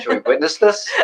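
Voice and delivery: very high, laughing tease. Retold or imitated. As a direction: giggle out the mock awe. Teasing, laughing.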